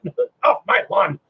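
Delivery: comic stomping knight, broken up by stuttering thuds; hammed-up knight, jerky and high at first; grumpy Nurgle knight. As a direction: play a knight shooing Marines off the lawn. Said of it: "a Nurgle knight walking up to a bunch of Marines going".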